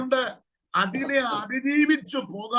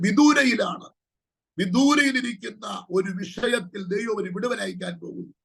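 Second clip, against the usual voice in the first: normal talking voice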